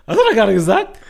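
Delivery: high and loud, can't believe what he heard; stunned disbelief. Retold or imitated. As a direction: shout out your shock, half laughing. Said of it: "Ich dachte mir so".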